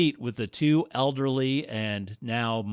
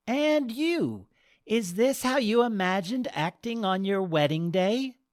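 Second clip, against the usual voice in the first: higher, pointed question; stern goddess voice; 11 semitones higher than usual. Acted. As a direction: lightly chide the bride.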